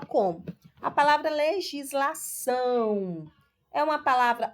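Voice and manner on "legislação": legislação with a drawn-out ÇÃO; stress on ÇÃO. Shown as a demonstration. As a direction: hold the last syllable ÇÃO of legislação. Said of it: "o são é a sílaba". (legislação alongando o ÇÃO final)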